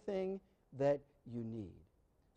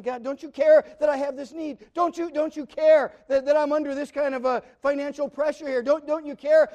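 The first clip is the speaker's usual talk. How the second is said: anxious plea; 7 semitones higher, 9 dB louder than usual. Acted. Worrier questioning God's care.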